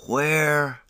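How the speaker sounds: a lightly rasped hoarse croak; hoarse question after smoke. A narrator's reading. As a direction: croak it out through a sore throat. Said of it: "I said hoarsely".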